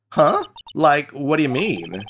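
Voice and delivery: lightly voiced ditzy Valley Girl; Valley Girl accent. Student greeting the lawyer in a Valley Girl accent. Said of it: "another Valley Girl accent".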